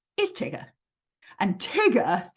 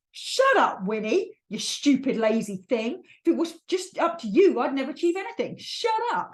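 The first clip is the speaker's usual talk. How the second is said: harsh scolding; 3 semitones higher than usual. Acted. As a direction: snap at a lazy companion in mock anger. Acting out harsh self-scolding of Winnie.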